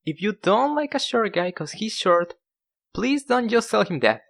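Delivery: normal talking voice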